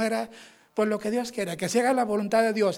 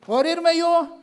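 incredulous; 9 semitones higher, 6 dB louder, much slower than usual. Retold or imitated. Incredulous reply about dying.

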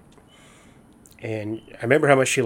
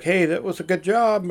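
much higher, mock stiff cheerful praise; forced praise; 9 semitones higher, 5 dB louder than usual. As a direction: imitate a boss forcing a compliment. Imitating coworker's forced encouragement.

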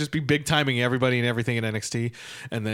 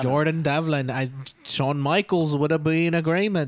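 slow, drawn-out mock Liverpool accent; imitated Liverpudlian, playfully overdone; 2 semitones higher than usual. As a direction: hold forth in put-on Scouse. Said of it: "This is [name]".

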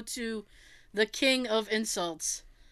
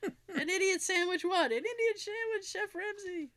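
high, small mock-pitiful voice; mock humiliated contestant; 10 semitones higher, 3 dB quieter than usual. Host plays contestant answering chef, mock pitiful. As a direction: squeak it high, fading softer.

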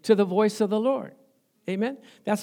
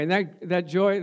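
normal talking voice

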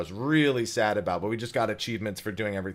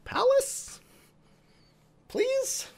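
high-pitched pleading; much slower than usual. Retold or imitated. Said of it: "I was like".

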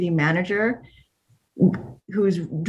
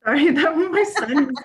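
laughing apology; 9 semitones higher, 4 dB louder than usual. Real, not acted. Apologizing for son's texts.

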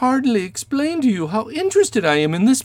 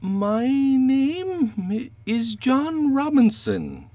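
very slow, halting and drawn out; hesitant; much slower than usual. Acted. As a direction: make up a name as you go. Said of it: "The man hesitated for an instant".

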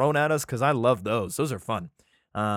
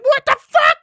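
a very high, very loud squawked "What the fuck?"; shrieking bird mama; 13 dB louder than usual. Retold or imitated. Mother bird finding her eggs gone, shrieking in outrage.

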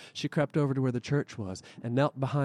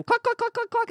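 high squawking cluck cluck; hen clucking; 3 dB louder than usual. Acted. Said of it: "called out".

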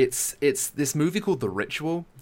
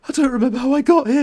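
high, loud, fast bewilderment; mock-frightened film character; acted panic; 12 semitones higher, 7 dB louder, faster than usual. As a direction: blurt it out in confused fright. Acting bewildered man from the film.

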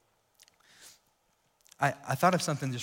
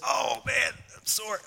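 mild groaning sigh while climbing in; groan of soreness; 8 semitones higher, slower than usual. Real, not acted. Pastor climbing into a kiddie pool, lightly groaning. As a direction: let out a light sore groan. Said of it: "You start making those noises".

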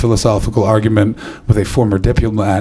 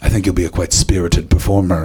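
lower, lightly posh English voice; a hint of plummy English host; mild posh English accent, pitched lower; 6 semitones lower than usual. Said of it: "he went".

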